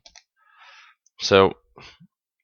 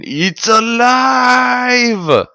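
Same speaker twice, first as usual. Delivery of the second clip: high, drawn-out triumphant shout; Frankenstein scream; 7 dB louder, much slower than usual. Acted. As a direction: scream it like a mad scientist, stretching the vowel. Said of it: "screams the wonderful line from Frankenstein".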